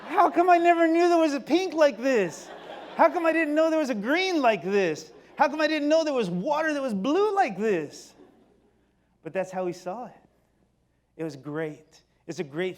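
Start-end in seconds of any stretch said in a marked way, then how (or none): 0.1-8.1 put-on high, loud, amazed kid voice; childlike wonder. Boy seeing color TV for the first time, amazed and loud.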